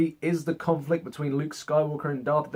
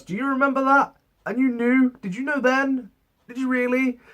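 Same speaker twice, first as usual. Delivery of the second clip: much higher, incredulous mock questioning; mock disbelief; 10 semitones higher, 3 dB louder than usual. The reviewer teasing Leia about always knowing, much higher and incredulous. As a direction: needle her with rising disbelief. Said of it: "I'm just looking at Leia like".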